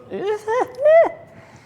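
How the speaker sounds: loud high mocking laugh; mocking cackle. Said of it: "she points at Fangs and goes".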